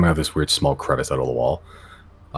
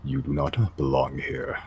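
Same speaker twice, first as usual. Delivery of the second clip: guttural voice; 8 dB quieter, slower than usual. Acted. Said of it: "in a guttural voice".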